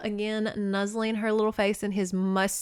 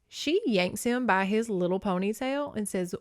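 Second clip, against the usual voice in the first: ordinary talk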